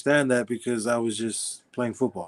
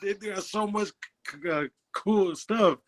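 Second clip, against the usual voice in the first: laughing through a silly admission; giggling while owning up to a goofy reason; 8 semitones higher, 3 dB louder than usual. Real, not acted. Laughing as he admits the uniforms won him over.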